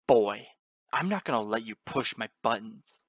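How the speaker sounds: loud, snarling angry father; hideous snarl. A narrator's reading. Father warning his young son, hideous loud snarl. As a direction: snarl it loudly at the boy. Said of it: "hideously snarled".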